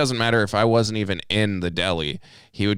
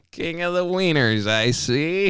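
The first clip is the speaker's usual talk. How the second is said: teasing catchphrase; 8 semitones higher, slower than usual. Retold or imitated. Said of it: "he says it back to me".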